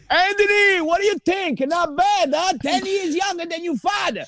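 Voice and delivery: put-on high excited Italian-accented dad calling out; dad shouting proudly. Proud father shouting to son at the airport, high and excited. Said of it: "He goes".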